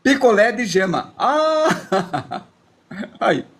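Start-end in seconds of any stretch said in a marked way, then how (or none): 1.2-3.4 laughing. Real, not acted. Laughing at own drawing.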